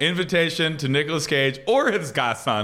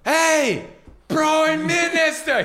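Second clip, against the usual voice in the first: high, drawn-out cartoon holler; calling out in character; 13 semitones higher, much slower than usual. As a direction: holler it across the yard, stretched out. Acts out a Simpsons scene.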